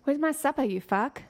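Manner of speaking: put-on South African accent, starting high; South African demand with a rising end; Stephen Hudson. Quotes him for the friend to copy, South African accent. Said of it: "He says".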